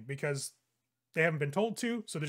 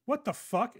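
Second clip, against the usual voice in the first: high, sharp shock; startled outburst; 8 semitones higher than usual. Acted. Security guard startled by the vampire's remark. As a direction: jolt up in pitch, caught off guard.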